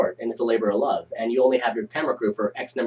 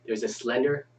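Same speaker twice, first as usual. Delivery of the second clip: ominous story narration; 3 semitones lower, 6 dB quieter than usual. Acted. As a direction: read it like a horror story. Reads scene setting ominously.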